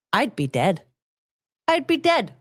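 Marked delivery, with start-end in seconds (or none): 1.7-2.3 high-pitched disbelief; incredulous exclamation. Acted. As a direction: exclaim it high, aghast at the very idea.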